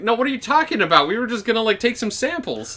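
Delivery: innocent doctor voice. Retold or imitated. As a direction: play it wide-eyed and innocent. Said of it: "they're like".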